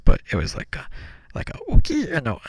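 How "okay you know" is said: brief high-pitched attempt at a toy voice; tentative high stuffed-monkey squeak; little monkey voice. Shown as a demonstration. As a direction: try out a little creature voice. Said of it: "like, a little voice".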